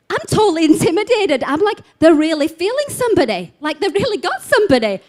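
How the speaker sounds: high, loud, agitated retelling; overawed and worked up, voice high and loud; intimidated awe. Retold or imitated. Retells own intimidated panic.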